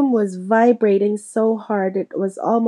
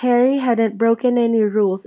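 usual speaking voice

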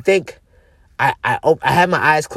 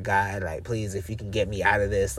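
lower, softer desperate plea; hushed prayer; 8 semitones lower, 10 dB quieter than usual. Retold or imitated. Retelling his prayer to God, hushed and pleading. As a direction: quietly beg God for rescue.

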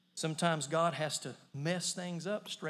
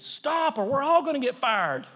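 talking lion; 7 semitones higher, 7 dB louder than usual. Retold or imitated. Lion speaks in joke punchline.

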